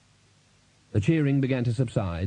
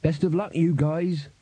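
small, higher-pitched voice; flat little voice; 5 semitones higher than usual. A narrator's reading. Battered rival musician wishing the band luck, flat little voice. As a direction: keep it little and high, without enthusiasm. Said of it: "said a flat little voice".

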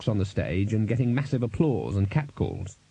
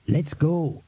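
plain reading voice

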